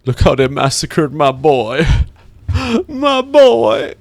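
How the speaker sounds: hammed-up grief, rising very high; mock movie lament. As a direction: ham up the famous lament with a laugh. Quotes famous film line.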